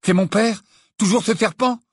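high, loud anxious questions; alarmed questioning. A narrator's reading. Boy fearing his father was attacked, alarmed.

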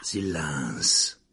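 low, hoarse whispered command. Acted. Lupin silences the compartment, hoarse and low. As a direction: rasp out the order. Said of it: "une voix rauque".

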